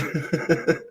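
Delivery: soft high giggle; light trailing chuckle, high-pitched. Real, not acted. Laughs at own quote.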